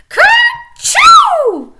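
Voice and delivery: big shouted kerchoo sneeze; loud sneeze. Acted. Peter sneezes in story.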